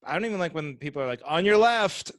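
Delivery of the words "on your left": loud, pushy warning; rude "on your left". Retold or imitated. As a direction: bark it like a rude cyclist. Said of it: "without it sounding like".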